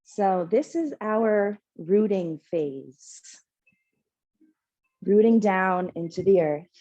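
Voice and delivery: calm, measured meditation voice with long pauses; guided meditation. Real, not acted. Yoga teacher leading the class into rooting breath, meditative calm. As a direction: guide them gently into stillness, unhurried.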